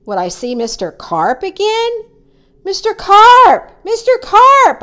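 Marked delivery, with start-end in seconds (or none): 2.6-4.8 high, loud calling out; yell. A narrator's reading. Boy searching the water for Mister Carp, yelling. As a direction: call after someone out of sight. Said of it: "I yelled".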